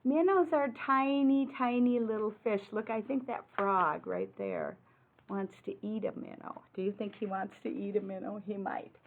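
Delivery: ordinary talk, nothing marked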